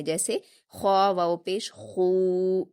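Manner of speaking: खू said heavy from the throat and stretched two counts; teacher demonstrates the heavy kha with long u; heavy full khu. Shown as a demonstration. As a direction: give खू a thick throaty long u. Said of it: "पुर भी पढ़ेंगे".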